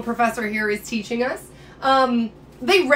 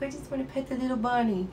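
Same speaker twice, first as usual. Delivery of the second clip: soft cutesy voice, as if cooing at a pet; 7 dB quieter than usual. Acted. Wanting to pet the little sea hare, cooing. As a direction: coo softly over a tiny animal. Voicing urge to pet cute animal.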